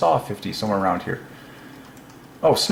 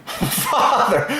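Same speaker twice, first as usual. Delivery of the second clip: laughing; 8 semitones higher than usual. Real, not acted. Laughs after owl hoot.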